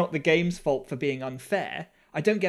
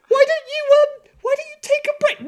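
high, loud, laughing forced-polite voice; mock strained niceness; 9 dB louder than usual. Husband tiptoeing around his angry wife, high loud strained politeness. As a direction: sound sweet while barely holding it together. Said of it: "I'll say something like".

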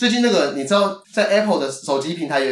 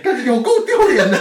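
laughing; 7 semitones higher, 4 dB louder than usual. Real, not acted. Embarrassed laughing at cohost.

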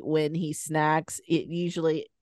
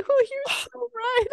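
much higher, slow, crying laugh; laughing mock defeat. Mother retells conceding to the eight-year-old, crying with laughter. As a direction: give in through laughter. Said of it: "And I was like".

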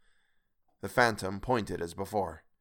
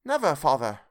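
higher, louder earnest cry; children's emphatic promise to father; 5 semitones higher, 5 dB louder than usual. A narrator's reading. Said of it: "cried they all".

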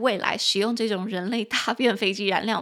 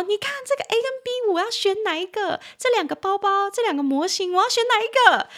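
much higher, eager friend asking which to pick; fussy friend; 11 semitones higher than usual. Retold or imitated. Friend asking which of two identical bags to choose, high and eager. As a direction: play a friend torn between two bags. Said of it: "就会跟你讲说".

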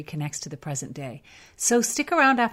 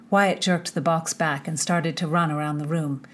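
measured audiobook reading voice; story narration; 5 dB louder than usual. A narrator's reading. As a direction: read the chapter like a storyteller. Reads the novel chapter aloud.

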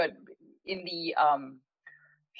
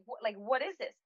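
higher but softly puzzled question; toned-down retold protest; 5 semitones higher, 4 dB quieter than usual. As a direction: ask it in restrained disbelief. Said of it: "Maybe not, maybe not in those words".

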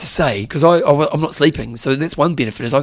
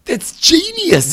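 delighted; 11 semitones higher, much slower than usual. Real, not acted. Delighted at mate's trip.

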